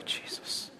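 breathy whispered Jesus, fed up; whispered exasperation; exasperated whisper. Retold or imitated. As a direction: whisper it under your breath in despair. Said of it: "I'm just like".

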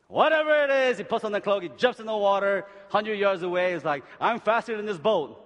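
loud, high, driving sermon voice; fired-up pulpit preaching; full-force preaching. Acted. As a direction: preach it out to the whole room.

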